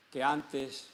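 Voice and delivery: usual speaking voice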